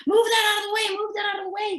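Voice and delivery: high urgent shouting; frantic yell. Retold or imitated. Someone yelling in the hall during the fight, high frantic shout. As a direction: bark the order in a panic. Retold screams from the hallway.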